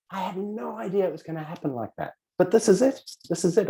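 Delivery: high-pitched acted astonishment; amazed. Caller amazed at how it happened, astonished. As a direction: marvel at it, high and thrilled.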